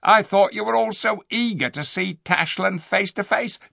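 mock their cowardice; jeering ape. Ape taunting the beasts, high and loud.

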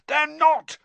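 high-pitched shouted contradiction; roar. A narrator's reading. Ron contradicting his friend's alarm, roaring. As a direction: roar back in denial. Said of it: "roared Ron".

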